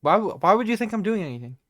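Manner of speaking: loud, fast, guilty protest; defensive denial. Acted. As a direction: protest too much, fast. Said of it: "acting so strange".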